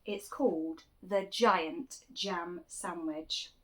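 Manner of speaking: ordinary delivery, nothing marked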